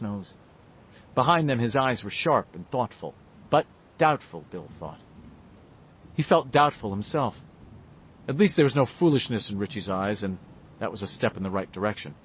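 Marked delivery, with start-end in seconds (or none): none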